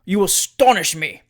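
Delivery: loud, amazed protest; astonished exclamation. A narrator's reading. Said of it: "exclaimed the mayor at length".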